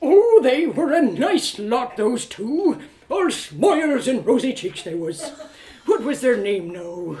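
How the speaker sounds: put-on fond, dawdling old innkeep; slow rambling recollection; maddeningly slow. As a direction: reminisce at a leisurely pace. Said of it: "Why was he talking so slow?".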